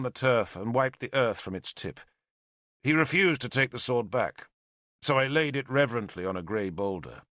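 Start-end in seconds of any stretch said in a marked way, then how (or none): none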